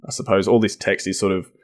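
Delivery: ordinary talk, nothing marked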